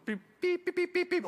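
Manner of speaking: high, even bleep noises; imitated censor beeps. Homeowner censoring his thoughts at bad news, high beeps. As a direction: beep it out like censored swearing. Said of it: "there's things I'm saying".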